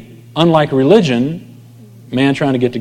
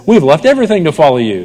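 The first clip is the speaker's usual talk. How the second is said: mock disciple; 5 semitones higher, 5 dB louder, faster than usual. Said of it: "The disciple says".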